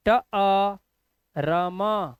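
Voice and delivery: "ट अ र म" sounded out one sound at a time. Shown as a demonstration. Teacher breaking the word down for learners, "ट अ र म" sound by sound. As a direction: spell out "ट अ र म" by its sounds, with pauses between. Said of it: "ई का उच्चारण आ".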